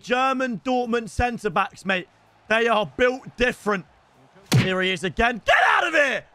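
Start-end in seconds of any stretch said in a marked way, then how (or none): 5.4-6.2 loud, high frustrated yell; furious shout at the screen; angry shout. Real, not acted. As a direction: blast it out in fury. Angry at opponent's goal.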